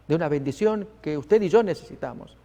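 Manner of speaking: ordinary talk, nothing marked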